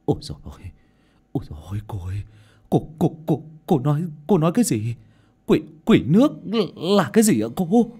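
shaken, stumbling disbelief; stammering shock. A narrator's reading. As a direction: stutter out your horrified question. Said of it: "thì lắp bắp".

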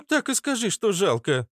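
put-on high, dismissive girlish retort; huffy girl. Girl refused a lesson, huffy and high. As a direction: snort it in a high voice. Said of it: "фыркнула девушка".

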